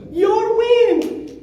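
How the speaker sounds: high, loud stress on wing; emphatic exclamation. Real, not acted. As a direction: punch the word wing.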